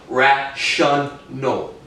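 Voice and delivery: "rational" pulled apart as ra-shun-al; syllable by syllable. Shown as a demonstration. Showing the shun inside the word, "rational" in slow pieces. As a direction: slowly sound out "rational", full SHUN in the middle. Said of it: "I pronounce shun".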